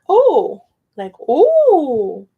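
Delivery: "oh" as one long rounded vowel; Spanish O. Shown as a demonstration. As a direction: hold a round "oh" for learners. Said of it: "the O is like".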